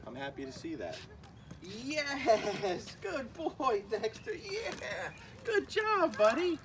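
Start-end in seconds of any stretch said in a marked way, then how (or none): 1.8-6.6 high, bright-eyed dog praise, much higher and climbing; excited praise. Real, not acted. Owner cheering on puppy Dexter, high excited praise. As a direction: gush over the puppy like he just aced it.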